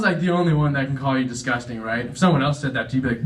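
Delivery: ordinary delivery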